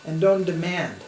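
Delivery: usual speaking voice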